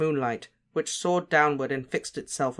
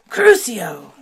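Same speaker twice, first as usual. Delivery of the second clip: loud, higher curse cry; spell shout; 5 semitones higher, 12 dB louder than usual. A narrator's reading. Casting a torture curse.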